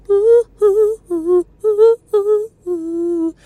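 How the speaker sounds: high held "ooh" wails; put-on high-pitched cooing; mock wailing oohs. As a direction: hoot long mocking oohs up high. Mimics her carrying on online.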